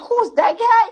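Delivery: high, laughing disbelief at a new voice; amazed who's that. Retold or imitated. Hearing the remix, asking who the singer is, high laughing surprise. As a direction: gasp it out, delighted and stunned. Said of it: "I'm like".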